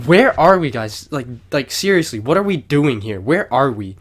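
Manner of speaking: imitating a lost friend, insistent and loud; loud bewilderment. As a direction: demand answers in mock confusion, punching the questions. Friend lost, acted out in story.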